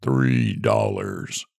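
low, slow tomb-like voice; sepulchral tones. A narrator's reading. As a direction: intone it from the grave. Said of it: "in sepulchral tones".

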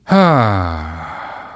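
long, audible sigh. Real, not acted. Host reacting to question about his childhood, long sigh. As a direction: let out a drawn-out sigh.

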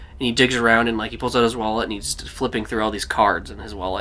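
ordinary talk, nothing marked

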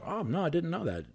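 high, mock-innocent surprise; surprised. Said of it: "And I was like".

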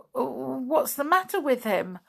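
high, fretful and miserable. A narrator's reading. Flip asks about his sick friend, miserably. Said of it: "asked Flip miserably".

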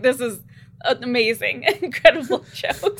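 loud laughing through the words; cracking up. Pretend. Nisha praising Toffee's joke, cracking up. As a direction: gush while laughing hard. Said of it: "is cracking up".

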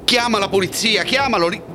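high urgent commands; frantic orders. Acted. Michel ordering the screaming woman to call police, frantic. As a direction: push her to act, fast. Urging the witness in panic.

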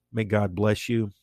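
ordinary talk, nothing marked

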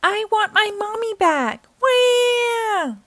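put-on high, wailing child's cry; child wailing for his mom. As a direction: wail like a little kid missing mom.

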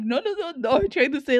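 high, quick no no no; flustered denial. Retold or imitated. Correcting a friend who misread her slang, flustered. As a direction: hurry to clear up the mix-up.

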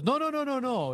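high, loud rapid-fire protest; emphatic denial. Retold or imitated. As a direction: imitate a friend loudly waving the idea away. Retold friend's hurried denial.

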